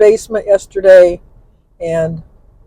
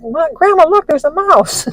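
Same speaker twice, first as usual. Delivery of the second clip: put-on child calling grandma; 8 semitones higher than usual. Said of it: "he said".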